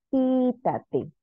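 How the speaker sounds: quítate with long QUÍ, then falling; stress on antepenultimate syllable. Shown as a demonstration. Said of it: "Tercera sílaba. Antepenúltima".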